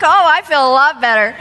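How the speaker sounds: mock relief. Sarcastic reply to Bob's joke.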